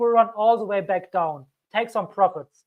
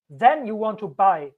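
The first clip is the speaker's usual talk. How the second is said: ordinary talk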